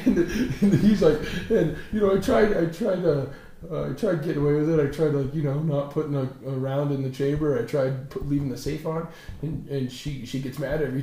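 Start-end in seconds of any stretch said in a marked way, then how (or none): none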